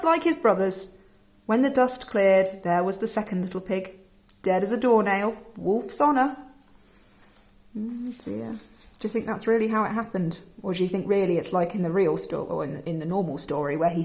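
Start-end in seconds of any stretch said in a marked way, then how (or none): none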